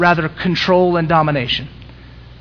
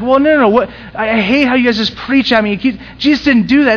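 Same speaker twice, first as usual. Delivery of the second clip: high, loud whiny critic; mock critic; 6 semitones higher, 4 dB louder than usual. Critic complains about being preached at, mock whiny protest. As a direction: ham up the annoyed critic.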